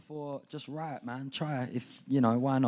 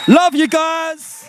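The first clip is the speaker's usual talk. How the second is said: drawn out, higher and loud to the crowd; loud thanks; 12 semitones higher, 23 dB louder, much slower than usual. Real, not acted. Poet calling out thanks to the whole room, loud and drawn out. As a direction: call it out warmly to the room.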